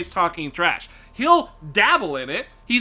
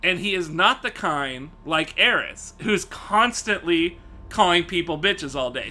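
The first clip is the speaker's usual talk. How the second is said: ordinary delivery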